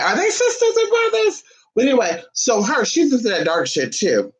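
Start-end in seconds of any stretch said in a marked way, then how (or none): 0.0-1.4 shooting way up high in disbelief; suddenly amazed question; surprised. Real, not acted. Host realizing two celebrities share a name, surprised.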